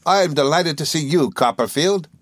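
put-on high, eager voice for an old schoolfellow; Traddles returning greeting. A narrator's reading. Traddles answering his old friend Copperfield, high and eager. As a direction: return the greeting in a lifted character voice. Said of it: "he returned".

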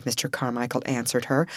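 ordinary delivery

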